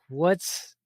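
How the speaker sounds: ordinary talk, nothing marked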